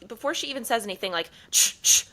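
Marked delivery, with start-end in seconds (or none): 1.5-2.0 voiceless shushing into the phone; mimicking a bank clerk's background shush; imitated shushing. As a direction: shush someone off the line. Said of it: "it's sounding on the other line".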